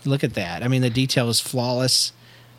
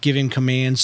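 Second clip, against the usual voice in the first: ordinary talk, nothing marked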